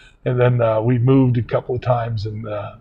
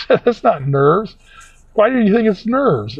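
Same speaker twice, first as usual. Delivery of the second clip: imitated indignant assistant, high and loud; retold protest in a raised voice; 10 semitones higher, 5 dB louder, slower than usual. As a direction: push back, pitched well up.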